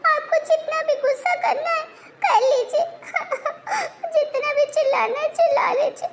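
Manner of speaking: high, sobbing and pleading, voice breaking; melodramatic sobbing plea. Acted. Wife begging husband through sobs not to stop talking to her, melodramatic sobbing plea. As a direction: beg through fake tears.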